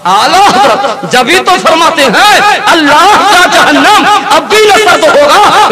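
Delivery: loud, high recited verse; fervent preaching. Acted. Preacher quoting Ala Hazrat's verse, loud and high. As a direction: declaim the couplet to the hall.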